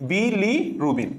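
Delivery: slow "বি", held apart from "লিরুবিন"; slow model answer, gap between "বি" and "লিরুবিন"; correct split pronunciation. Shown as a demonstration. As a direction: break after "বি", then say "লিরুবিন". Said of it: "তাহলে সঠিক উচ্চারণ কোনটা?".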